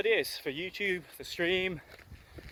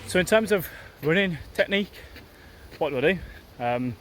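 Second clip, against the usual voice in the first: breathy, loud talk mid-run; speaking while jogging; 5 dB louder than usual. Real, not acted. Talks while running, loud.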